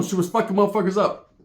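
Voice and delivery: normal talking voice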